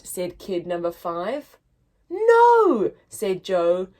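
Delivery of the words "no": much higher, louder indignant no. Acted. Joe indignantly rejects the kid's accusation. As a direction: snap back indignantly. Joe denies hiding leg.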